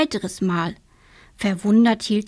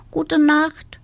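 a sleepy hen says good night; 8 semitones higher, 4 dB louder than usual. Acted. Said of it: "wünschte die weiße Henne".

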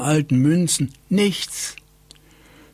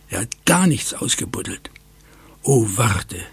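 usual speaking voice

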